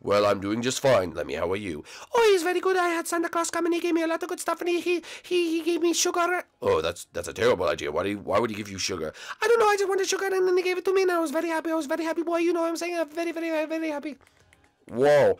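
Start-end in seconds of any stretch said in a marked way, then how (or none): 2.1-6.4 put-on very high, fast, overjoyed kid voice; tiny high voice bursting with joy at seeing dad; squeaky excited Lemmy. Lemmy's voice continues. 9.4-14.2 put-on very high, fast, overjoyed kid voice; tiny high voice bursting with joy at seeing dad; squeaky excited Lemmy. Lemmy's voice continues.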